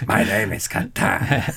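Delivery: slow, put-on conquistador accent; a hint of a Spanish conquistador. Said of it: "Conquistador voice".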